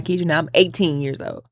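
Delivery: ordinary talk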